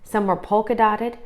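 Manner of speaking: ordinary delivery, nothing marked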